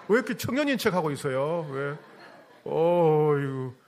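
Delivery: ordinary delivery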